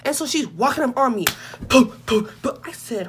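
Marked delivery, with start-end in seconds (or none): 1.7-2.6 short percussive booms; stomping footsteps. Retold or imitated. Narrator mimics relative walking up on her, stomping sounds. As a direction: punch out each boom like a heavy step.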